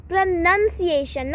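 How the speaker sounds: "pronunciation" slowly, with NUN in the second syllable; correct pronunciation. Shown as a demonstration. Teacher correcting learners, "pronunciation" slowly modelled with a short NUN. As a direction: say "pronunciation" as pruh-nun-see-ay-shun, drawn out. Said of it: "not pronunciation".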